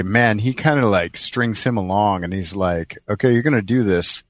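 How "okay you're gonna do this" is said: ordinary delivery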